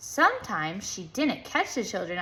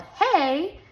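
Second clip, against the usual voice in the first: loud, higher yell of hey; 8 semitones higher, 5 dB louder than usual. A narrator's reading. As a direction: shout it on the dance's big beat. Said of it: "When she yelled".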